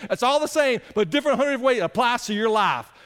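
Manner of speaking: normal talking voice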